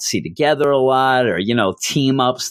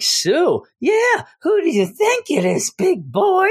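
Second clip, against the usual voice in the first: much higher hammed-up taunting voice; mock high taunt. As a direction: tease the big guy in a squeaky put-on voice. Fungus taunting [name] in stolen voices.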